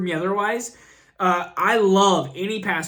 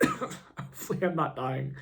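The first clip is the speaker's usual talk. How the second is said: softer, slow, worn out from coughing; spent after a cough; 5 semitones lower, 10 dB quieter than usual. Real, not acted. The vlogger, sick on camera, worn out after coughing. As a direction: let it fade, drained by the cough. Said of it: "I wanna cough some more".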